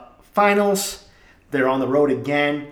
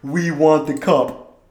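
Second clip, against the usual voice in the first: slow, drawn-out fan chant, louder; mocking bandwagon fans; 2 semitones higher, 5 dB louder, much slower than usual. Mocking the new Bruins fans at games, drawn-out chant. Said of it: "talking like".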